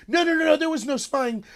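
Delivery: high, loud mocking no no no; mock denial. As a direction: parody an indignant official brushing it off. Mocking officials' flat denial.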